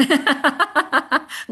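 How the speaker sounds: high, loud burst of real laughter; laughing. Real, not acted. Preacher amused by her own acting, laughing. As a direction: crack up at your own performance.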